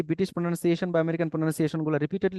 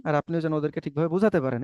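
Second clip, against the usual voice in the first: ordinary delivery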